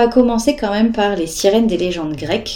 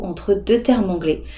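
normal talking voice

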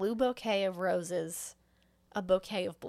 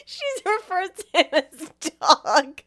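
higher, louder, laughing through it; laughing answer; 7 semitones higher, 7 dB louder than usual. Real, not acted. Answering while cracking up.

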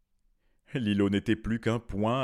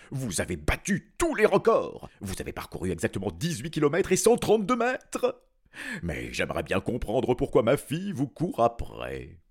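king's voice; 4 semitones higher, 6 dB louder than usual. A narrator's reading. King addresses Lilo.

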